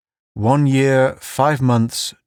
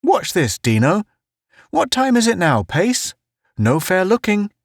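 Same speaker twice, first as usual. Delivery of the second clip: Town's voice; 10 semitones higher than usual. A narrator's reading. Town character voice.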